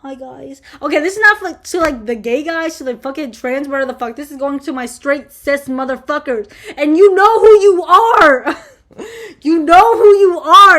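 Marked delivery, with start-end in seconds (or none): 0.0-0.6 pouty greeting. Retold or imitated. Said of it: "pouting at the camera". 6.8-10.8 loud, laughing call-out; laughing shout. Real, not acted. Calling out straight men.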